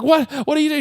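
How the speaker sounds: high-pitched flustered questioning; mock alarm. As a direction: imitate a man caught off guard. Startled man retold in robbery scene.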